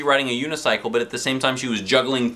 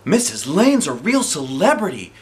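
put-on girl's voice; 7 semitones higher, 4 dB louder than usual. A narrator's reading. Andrea voiced.

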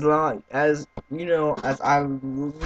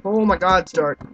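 ordinary delivery

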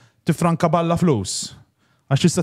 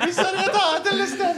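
laughing. Real, not acted. Laughing at the photo.

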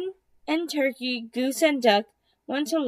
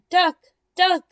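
calling out; 6 semitones higher, 6 dB louder than usual. Acted. Hen calling out to Duck.

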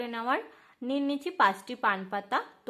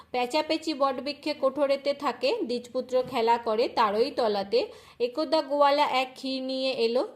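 rhythmic, sing-song verse reading, a little louder; panchali recitation; 4 dB louder than usual. Acted. Devotee reading the Bhadra month Lakshmi tale aloud, rhythmic sing-song recitation. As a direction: recite the holy tale in steady chanting rhythm.

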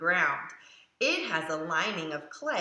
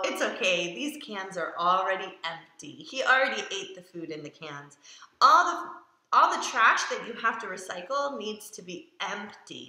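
plain reading voice

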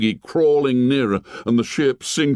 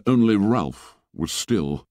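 ordinary delivery

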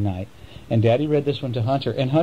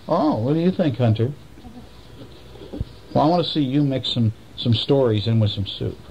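usual speaking voice

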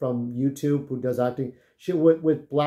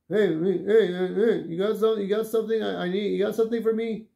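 pestering stranger; 5 semitones higher than usual. Retold or imitated. Stranger on train pestering him.

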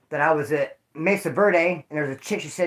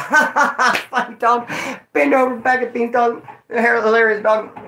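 higher, louder put-on laughing voice; mock laughing; 6 semitones higher, 4 dB louder than usual. Inmate reacting to a friend's joke, mock hilarity. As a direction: laugh through a jokey retort. Retells his sarcastic laughing reply.

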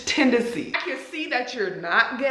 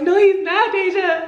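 high pleading protest; drunk friend denying; 8 semitones higher than usual. Retold or imitated. Drunk Stacy insisting to Deja, high and pleading. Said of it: "she was like".

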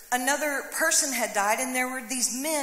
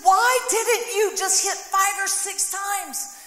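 higher, louder and slow, scolding; rebuking; 7 semitones higher, 4 dB louder, slower than usual. Retold or imitated. Prophet rebuking the king for stopping short, slow higher scolding. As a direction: scold him with every word drawn out. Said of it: "the man of God rebukes him".

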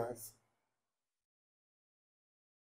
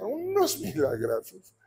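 mock awe; 12 semitones higher, slower than usual. Mocking the testimonies' miracles.

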